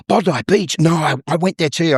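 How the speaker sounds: high, gasping delight; mock excited recognition. Panel member hearing of Bondi, mock excitement. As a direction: imitate a thrilled stranger finding common ground. Acting out thrilled small talk.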